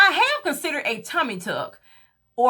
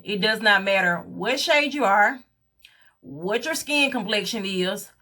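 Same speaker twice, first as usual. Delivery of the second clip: ordinary talk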